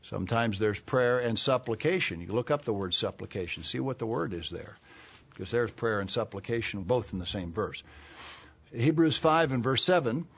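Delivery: ordinary talk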